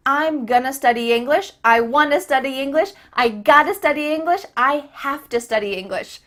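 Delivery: brisk drill of reduced forms, linked; quick model sentences, words run together; fast connected speech. Shown as a demonstration. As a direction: rattle off gonna, wanna, gotta, hafta. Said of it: "and it's so fast".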